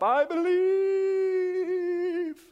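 high drawn-out mock wail of faith; mock cry of faith. Pastor mocking a jumper, high drawn-out wail. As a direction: wail it like you're falling.

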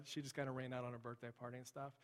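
ordinary talk, nothing marked

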